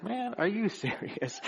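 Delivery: half-muttered, can't-believe-it quip; incredulous aside. Retold or imitated. Preacher mimics his own reaction to neighbors, mild disbelief. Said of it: "and it's just like".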